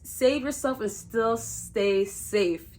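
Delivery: slow, careful tongue-twister delivery; deliberately slow. Shown as a demonstration. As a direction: say it slowly, word by word. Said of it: "You gotta say it slow".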